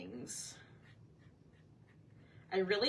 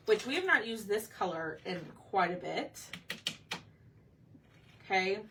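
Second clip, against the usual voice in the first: ordinary talk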